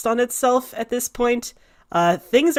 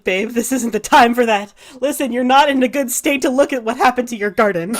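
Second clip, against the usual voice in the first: urgent comfort; 5 semitones higher, 5 dB louder than usual. Acted. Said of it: "Escher's like".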